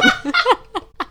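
high loud burst of laughter; real laughing fit. Real, not acted. Laughing at own story.

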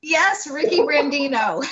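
laughing through the name, a bit slow; laughing yes. Real, not acted. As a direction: laugh as the guess lands right. Laughs confirming the guessed name.

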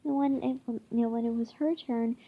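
plain reading voice

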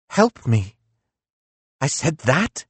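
Nietzsche voice. Acted.

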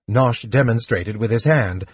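plain reading voice

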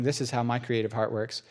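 usual speaking voice

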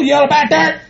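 ordinary delivery

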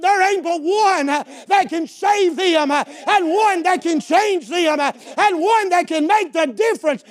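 high, driving sermon cadence; rousing pulpit exhortation; full-force preaching. Real, not acted. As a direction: preach in loud, punched phrases building to a climax.

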